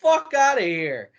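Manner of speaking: put-on drunk Joey Janela. Janela drunkenly throws the storyteller out, high mock voice. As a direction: shoo them off in a drunk mock voice. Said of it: "He's like".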